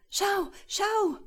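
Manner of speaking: high excited call. Acted. Girl shows her sister the little man, high excited call. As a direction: call out urgently, pitched right up. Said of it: "rief Wehmut".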